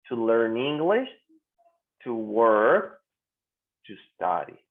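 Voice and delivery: "to learn English", "to work", "to study" each said apart; pronunciation model. Shown as a demonstration. Teacher urges students to practise, careful separated model of "to learn English, to work, to study". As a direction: drill "to learn English", "to work", "to study" with long pauses between, dropping low on "study". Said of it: "Ahí practiquen".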